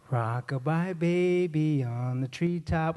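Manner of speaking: slow, drawn-out lullaby recital; stretched-out storybook reading; nursery rhyme reading. Acted. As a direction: recite it slowly and deliberately.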